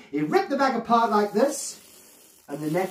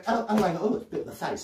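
usual speaking voice

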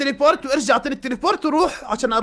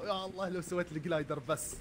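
ordinary delivery

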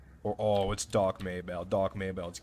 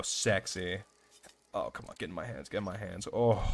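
ordinary delivery

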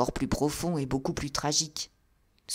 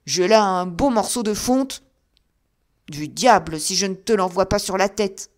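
louder, raised threat; shouted threat; 3 semitones higher, 8 dB louder than usual. A narrator's reading. Old man threatening to drop cast iron on him, loud shout.

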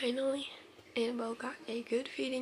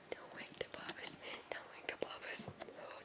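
soft unvoiced whisper; 10 dB quieter than usual. Real, not acted. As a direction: hush it so nobody wakes. Repeated whisper.

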